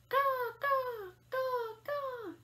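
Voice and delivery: high, loud falling caws; imitating a crow's cry; crow call. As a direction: caw out like a crow. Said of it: "Crow calls out".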